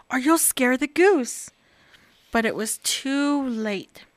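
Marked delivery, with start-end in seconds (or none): none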